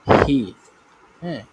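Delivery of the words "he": "he" as a louder, standalone English word; English pronunciation. Shown as a demonstration. Teacher showing students the English word, pronunciation demo of "he". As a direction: say "he" on its own, punched for learners. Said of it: "ইংরেজিতে এটার উচ্চারণটা হলো".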